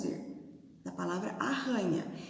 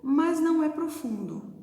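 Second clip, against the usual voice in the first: a louder, sing-song rhyme with the last word lifted; 6 semitones higher, 5 dB louder than usual. Shown as a demonstration. Teacher reciting her new rhyme to the class, sing-song and louder. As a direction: recite it proudly so the rhyme lands. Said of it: "eu daria o final".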